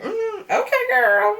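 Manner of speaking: put-on high, drawn-out side-eye okay girl; unimpressed, laughing, up high; mock-polite okay girl with a giggle; sassy okay girl. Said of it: "I was just kinda like".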